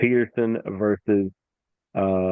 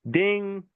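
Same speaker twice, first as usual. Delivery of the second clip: high-pitched spoken bell ding; high, ringing "ding"; imitated bell ding; 10 semitones higher than usual. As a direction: ring it out like the fight bell. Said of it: "that's the sound we hear".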